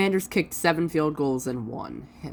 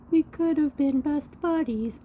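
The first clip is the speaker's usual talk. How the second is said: cringey sweet voice; 12 semitones higher than usual. Pretend. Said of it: "I'm gonna stop being cringe".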